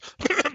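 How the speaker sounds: sharp high cough; a dwarf coughing to interrupt. Acted. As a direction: fake cough covering a word. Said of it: "Balin coughs".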